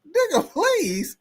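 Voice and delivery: high, slow, drawn-out mimicry; mock him slowly through laughter; put-on high voice, stretched out.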